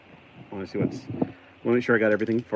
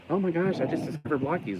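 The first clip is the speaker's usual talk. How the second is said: high-pitched mock excitement; imitated excited newcomers; 8 semitones higher than usual. Imagined Americans finding Blockies late, mock excitement. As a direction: gush like a thrilled latecomer, mockingly. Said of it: "Everybody in America will be like".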